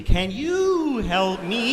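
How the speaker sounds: high, very drawn-out tuning tone; exaggerated sustained high plea; searching for his frequency. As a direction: hold each word long and high like a signal. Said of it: "find his frequency".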